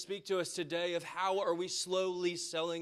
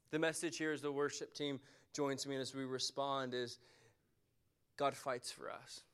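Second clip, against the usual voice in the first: softer, lower, intimate preaching; quiet altar call; 7 semitones lower, 3 dB quieter than usual. Acted. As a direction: draw them in gently as the music starts. Reflective altar call, worship team joins.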